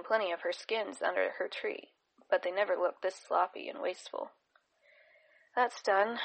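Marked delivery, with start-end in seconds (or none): 5.5-6.2 confident but shaky. A narrator's reading. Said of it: "with a confident but slightly shaky tone".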